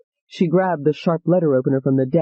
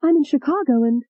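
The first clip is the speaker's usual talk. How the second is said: voiced young woman; 7 semitones higher than usual. Alette phones her father in panic.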